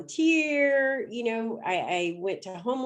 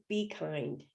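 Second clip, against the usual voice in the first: a soft, hushed "Be kind"; heard voice; 5 semitones lower, 9 dB quieter than usual. Retold or imitated. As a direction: drop almost to nothing on "Be kind". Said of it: "That's what I heard".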